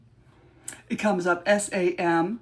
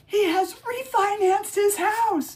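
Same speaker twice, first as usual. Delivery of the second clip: much higher, hammed-up witch; mock witch voice; 12 semitones higher than usual. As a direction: melodramatic witch, pitched high. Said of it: "I need an Oscar".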